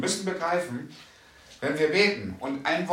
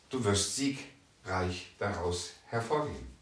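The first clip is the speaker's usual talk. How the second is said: ordinary talk, nothing marked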